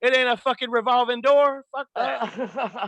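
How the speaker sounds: high-pitched comic exasperation; exasperated rant. Acted. Telling nosy friends about adjoining rooms, comic exasperated rant. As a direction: rant it high, breaking into a laugh at the end.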